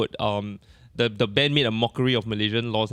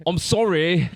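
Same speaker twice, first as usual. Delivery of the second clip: higher, louder mock British accent; put-on British accent; 6 semitones higher, 6 dB louder, much slower than usual. Podcaster redoing the band's apology on request, put-on British accent. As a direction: ham up a British singer's accent. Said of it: "Use, use the correct accent".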